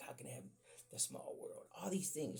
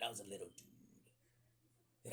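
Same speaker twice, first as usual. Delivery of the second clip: slow breathy whisper, softer; 5 semitones lower, 9 dB quieter, much slower than usual. Shown as a demonstration. Telling the audience he was small, in a slow whisper. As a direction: let it fall to a hushed whisper. Repeated line, whispered take.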